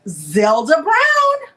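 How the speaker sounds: loud, high, drawn-out name reveal; excited winner announcement. Real, not acted. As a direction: belt out the winner's name, stretching it.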